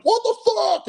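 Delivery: high, loud mock outrage; mock road rage. Streamer imitating a raging driver, high mock outrage. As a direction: yell it like an angry driver. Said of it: "in their car like".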